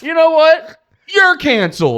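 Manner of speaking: high, loud joking indignation with a laugh; mock outrage. Teasing his co-host with a mock-furious shout. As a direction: declare him cancelled in hammed-up fury.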